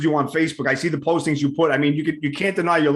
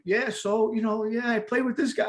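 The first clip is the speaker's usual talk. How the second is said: laid-back mumble; 4 semitones higher, 7 dB quieter than usual. Retold or imitated. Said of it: "you're like".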